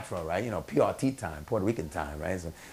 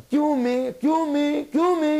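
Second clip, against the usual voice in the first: high, loud mock stage call; imitating a promoter shouting for attention; Federico Pagani; 6 dB louder, slower than usual. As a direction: call out over a rowdy crowd in a put-on high voice. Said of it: "the guy through Federico comes out".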